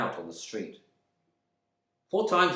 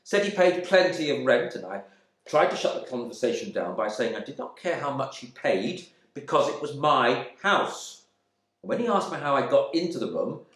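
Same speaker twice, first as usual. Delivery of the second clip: ordinary delivery, nothing marked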